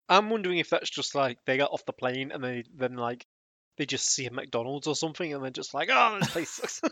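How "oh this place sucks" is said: higher-pitched groan of disappointment; mock disgust, pitched up; mock dismay. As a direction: play a let-down tourist's whine. Tourist's mock disappointment on arriving in Paris.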